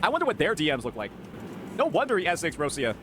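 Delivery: ordinary talk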